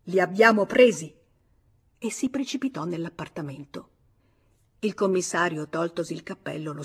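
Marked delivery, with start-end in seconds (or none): 0.0-1.1 loud cry of victory; husband exulting at the capture; triumphant exclamation. A narrator's reading. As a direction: crow that you caught them. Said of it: "esclamò George".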